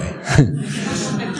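quiet, breath-only laugh; soft chuckle. Real, not acted. Teacher amused by his grammar joke, soft chuckle. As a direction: chuckle softly to yourself.

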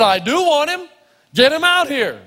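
high-pitched, emphatic retort; insistent demand. Retold or imitated. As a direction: overrule the objection, acting it out. Retells insisting on that caddy.

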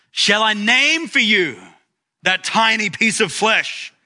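mild mock yell, pitched high; retold pulpit yell. Preacher retelling a priest's challenge to his congregation, mock yell. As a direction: yell it lightly, voice lifted well up. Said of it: "Werner leaned over the pulpit and yelled".

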